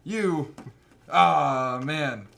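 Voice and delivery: loud, astonished mock captain; hammed-up loud surprise; Vince Vaughn. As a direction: play an officer eating his words, loudly. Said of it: "he comes over to Vince Vaughn".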